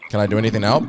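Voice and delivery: normal talking voice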